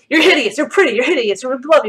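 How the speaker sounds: rapid-fire alternating verdicts, high and loud; mock flip-flopping. Streamer summing up online people's comments, mock flip-flopping. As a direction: parody commenters contradicting each other.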